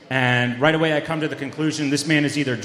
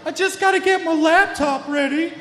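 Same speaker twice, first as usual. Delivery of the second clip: much higher, dazed Southern chef voice; Muppet chef voice; 14 semitones higher than usual. Retold or imitated. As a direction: imitate the spaced-out guy in the alley. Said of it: "like Chef from the Muppet Show if he had a Southern accent".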